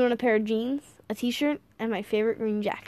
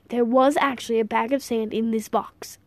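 ordinary delivery, nothing marked

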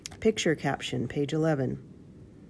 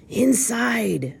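chilling blind villain, pitched high; chilling command; 8 semitones higher, 4 dB louder than usual. A narrator's reading. Blind man sends his gang into the inn, chilling command. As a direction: order them in with a chilling cry. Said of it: "a chilling voice commanded".

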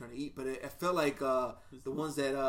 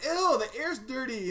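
higher, slow, mildly aggressive lady voice; mock aggressive Jersey lady; 10 semitones higher, 5 dB louder, slower than usual. Jersey lady scorning Portland to him, slow high mock disgust. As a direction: imitate the pushy Jersey woman, stretched out. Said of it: "very aggressive".

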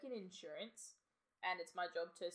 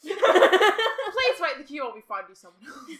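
loud, slow, laughing announcement; 7 semitones higher, 21 dB louder, slower than usual. Pretend. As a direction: read it out while cracking up. Mock call center hold.

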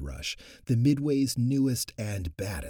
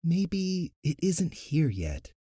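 pondering; 8 semitones higher, slower than usual. A narrator's reading. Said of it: "Zia pondered".

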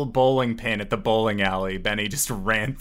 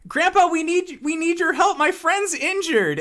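very high-pitched plea for help; pleading granddaughter. Acted. Girl begging Grandpa to help injured friend, high plea. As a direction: call urgently on Grandpa for a hurt friend. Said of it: "and she says".

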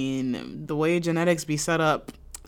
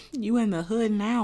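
laughing taunt; 6 semitones higher than usual. Retold or imitated.

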